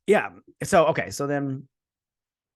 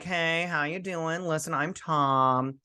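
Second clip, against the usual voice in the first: lightly voiced, slow troll greeting; casual troll; 3 semitones higher, 3 dB quieter, slower than usual. Troll introducing himself to captives, casual. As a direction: draw out an easygoing troll hello.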